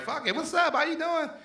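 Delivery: put-on high-pitched kid greeting strangers; cheeky high mimicry of a child. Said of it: "Her kids spoke to, they spoke to strangers".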